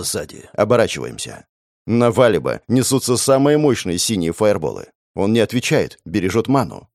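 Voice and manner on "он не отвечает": usual speaking voice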